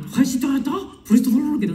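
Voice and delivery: mock German. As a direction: imitate a garbled German airport announcement. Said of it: "Karena lo kita udah Jerman".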